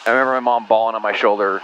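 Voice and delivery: normal talking voice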